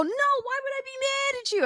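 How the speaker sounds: very high, over-defensive protest; mock protest. Someone answering "are you mad at me", high mock-defensive squeal. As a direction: imitate someone squealing their innocence.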